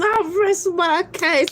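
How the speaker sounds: high, grand lawyer's closing; mock closing lawyer. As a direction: deliver it like a triumphant attorney.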